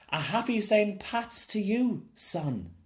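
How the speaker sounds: high, loud shout to the rising sun; joyful shout. Acted. Boy greeting the sunrise from the stage, shouting with joy. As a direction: call it out big across the empty field. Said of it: "Jamie shouted".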